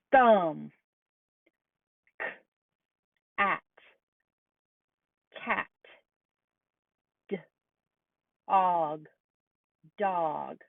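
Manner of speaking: K at said apart, then cat blended; segmented sounds then blended. Shown as a demonstration. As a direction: sound out each part slowly, then blend the word. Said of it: "listen to these sounds. What is the word?".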